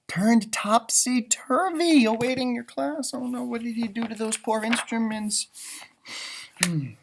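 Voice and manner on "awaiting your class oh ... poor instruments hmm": plain reading voice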